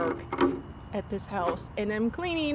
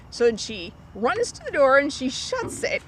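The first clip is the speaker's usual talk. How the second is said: usual speaking voice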